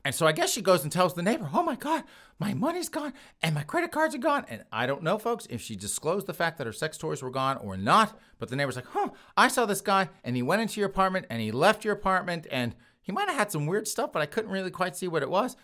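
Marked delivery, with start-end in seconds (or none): 1.5-4.4 much higher falsetto, upset woman reporting theft; mock distressed woman. Victim tells the neighbor. 8.9-15.6 higher, gossipy neighbor voice, lightly played; a hint of a chatty neighbor telling all; mock nosy neighbor. Neighbor voiced describing intruder.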